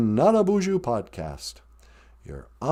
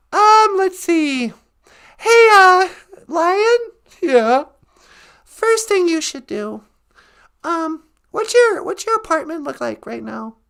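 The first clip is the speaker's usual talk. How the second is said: Natasha voice; 11 semitones higher than usual. Acted. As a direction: greet him in a bright high character voice. Natasha voice advises the Lion.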